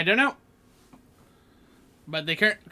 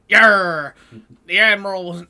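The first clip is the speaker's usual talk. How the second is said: loud mock pirate; badly faked pirate accent, loud; 9 dB louder than usual. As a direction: bellow a hammy pirate "Yarr". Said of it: "probably pirate accent".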